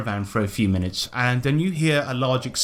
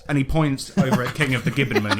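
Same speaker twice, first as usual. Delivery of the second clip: ordinary talk, nothing marked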